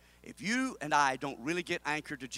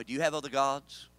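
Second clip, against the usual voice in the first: ordinary talk, nothing marked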